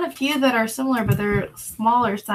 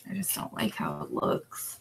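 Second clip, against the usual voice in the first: whisper; 9 dB quieter than usual. Real, not acted. Whispered aside while listing.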